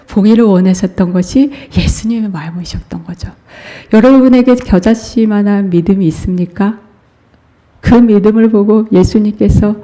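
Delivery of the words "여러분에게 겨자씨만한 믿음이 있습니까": ordinary talk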